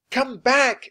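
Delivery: very high, loud shout; high-pitched urgent yell. A narrator's reading. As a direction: shout after someone running away. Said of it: "Annie shouted".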